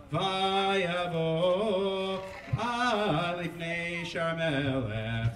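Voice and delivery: steady liturgical chanting of scripture; a cantor reading the scroll; Megillah cantillation. Acted. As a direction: chant the Hebrew verses in the traditional trope. Chanting Megillah chapter four.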